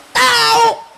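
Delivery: "Tahu" high and loud, Betawi style; Betawi intonation. Shown as a demonstration. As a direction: throw out "Tahu" as a loud Betawi exclamation meaning no idea. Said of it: "Kalau orang Betawi bilang". ("Tahu" dengan intonasi Betawi, tinggi dan kencang)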